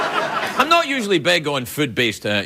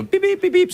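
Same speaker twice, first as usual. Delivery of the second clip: quick, very high-pitched beeps; imitated alarm beeps; 13 semitones higher than usual. Alarm goes off in Drew's house, piercing mimicked beeps. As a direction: mimic an electronic alarm going off.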